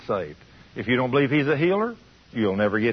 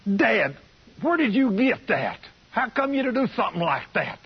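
much higher, indignant questioning; upset son; 9 semitones higher than usual. Retold or imitated. Grown son confronting his dad over the shirt, upset and high. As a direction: challenge your father, high and upset. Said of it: "He was all upset at Daddy".

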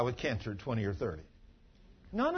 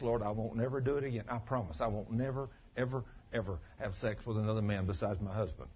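quiet promise; 4 semitones lower, 6 dB quieter than usual. Retold or imitated. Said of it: "made God a promise".

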